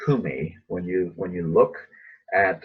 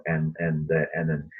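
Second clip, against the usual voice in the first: normal talking voice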